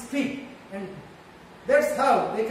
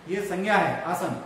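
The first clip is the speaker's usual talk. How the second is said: ordinary talk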